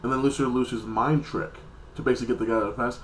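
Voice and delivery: normal talking voice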